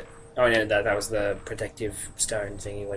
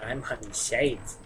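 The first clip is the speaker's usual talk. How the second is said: hushed hunter parody; 9 dB quieter, slower than usual. Pretend. Fudd parody quiet.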